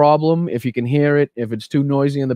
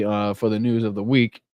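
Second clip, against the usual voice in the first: normal talking voice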